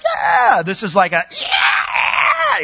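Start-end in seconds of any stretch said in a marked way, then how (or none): 0.0-0.6 high, raised crowd whoop; concert cheer. Shown as a demonstration. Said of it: "not like in a concert where you're like". 1.3-2.6 very high, piercing imitation scream; blood-curdling scream. Shown as a demonstration. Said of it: "it's just a blood-curdling scream".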